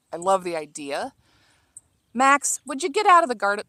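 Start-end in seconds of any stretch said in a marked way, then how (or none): 2.1-3.6 higher, louder call at the dog. Real, not acted. Gardener yelling at her dog Max to leave the garden, sharp call.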